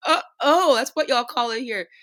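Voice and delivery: high, loud "oh" of dawning understanding; mock sudden realisation. Visiting ministers catching on to local wording, playfully surprised.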